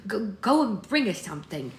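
loud, urgent order; commanding. A narrator's reading. Blessing, tearful, sends Prudence for needles, commanding. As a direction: order them off with a slight stumble of urgency. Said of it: "she commanded".

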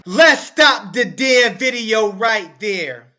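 loud and slow, halting the clip; slow, loud outburst cutting the clip off; annoyed shout. Real, not acted. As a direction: slam the brakes on the video, fed up. Cuts off the clip, outraged.

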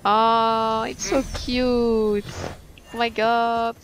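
high, drawn-out cooing; cooing delight. Real, not acted. Player finding the tamed animal so cute, cooing delightedly. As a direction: stretch the words in high, loud adoration.

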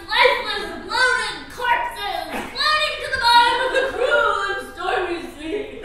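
loud, high, drawn-out melodramatic cries; frantic demand. Pretend. Woman demanding husband choose before all drown, melodramatic shouting. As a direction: wail a doom-laden warning, easing at the end. Demanding a choice as boat sinks.

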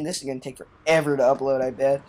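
usual speaking voice

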